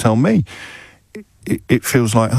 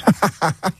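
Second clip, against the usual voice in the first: laughing; 8 semitones higher than usual. Real, not acted. Host laughs at caller.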